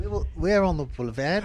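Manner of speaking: softly attempted Australian accent. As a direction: try an Aussie accent, quietly. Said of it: "say it in an Australian accent".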